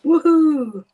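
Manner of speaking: loud, high woohoo; excited cheer. Real, not acted. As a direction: celebrate a perfect result. Celebrates straight sentiment.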